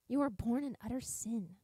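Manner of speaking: sneering, scornful accusation; mock the shamed like a cruel accuser. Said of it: "this mocking".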